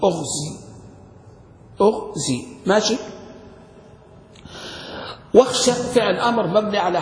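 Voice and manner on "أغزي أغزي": أغزي begun with "u", as ughzi; أغزي pronounced "ughzi", not ighzi; damma on the hamza. Shown as a demonstration. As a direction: say أغزي deliberately with an opening u. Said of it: "والأصل الزين مضمومة".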